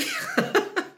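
high burst of laughter; real amused laugh. Real, not acted. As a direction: laugh out loud at yourself. Laughs at own admission.

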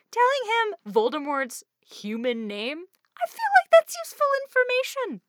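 high, loud incredulous complaint; mock exasperation. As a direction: push it up high as if stating the obvious. Mock-exasperated complaint about withheld information.

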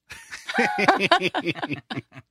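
giggling burst of laughter. Real, not acted. Laughs after saying moist.

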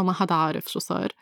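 normal talking voice

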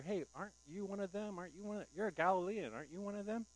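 high, pointed questioning; mock accusing voice; accusing bystanders. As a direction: needle him like a suspicious crowd. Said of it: "people kept asking Peter".

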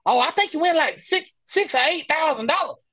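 high, casual boast; imitating stranger. Stranger telling the hustlers the prize in a high voice. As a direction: toss off the prize amount.

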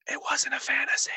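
unvoiced commercial whisper. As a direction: hush it like an ad tagline. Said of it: "more whispering".

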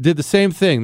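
ordinary delivery

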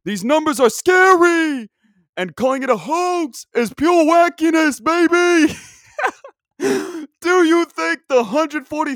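slow and bellowing, the hype cranked all the way up; Dick Vitale impression. Podcaster mocks hoax believers in a sportscaster's hollering voice. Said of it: "This is my impression of Dick Vitale".

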